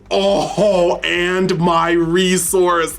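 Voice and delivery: high, thrilled card reveals; excited. Real, not acted. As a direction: list each pull in disbelief. Flipping through god pack cards.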